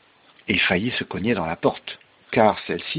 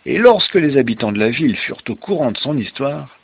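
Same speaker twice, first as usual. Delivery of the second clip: story narration; 3 semitones higher, 9 dB louder than usual. Acted. Father ending the tale for a child, story narration. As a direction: bring the tale to a big finish.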